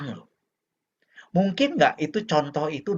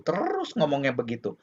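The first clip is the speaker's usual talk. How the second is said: ordinary talk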